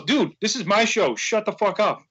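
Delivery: imitating a slow, deliberate scolding; retold dead-serious rebuke. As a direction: lay down the law like the star of the show. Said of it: "dead serious".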